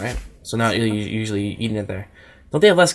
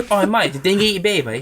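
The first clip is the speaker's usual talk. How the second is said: higher, hammed-up Australian accent; mock Australian accent; 7 semitones higher than usual. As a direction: ham up an Aussie.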